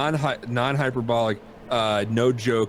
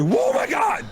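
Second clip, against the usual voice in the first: startled yell; 5 dB louder, slower than usual. Retold or imitated. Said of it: "I was like".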